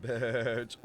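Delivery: ordinary talk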